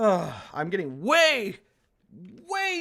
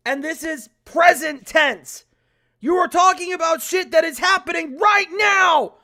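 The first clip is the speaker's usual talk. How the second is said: loud, higher exasperated shout; angry rant; 6 semitones higher, 8 dB louder than usual. Real, not acted. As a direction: yell the grammar rule. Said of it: "getting so angry".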